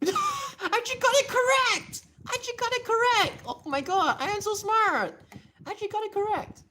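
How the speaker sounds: very high, loud gleeful squeal; thrilled, high-pitched bragging; elated squealing. Real, not acted. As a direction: squeal with delight at your own success. Elated at getting it right.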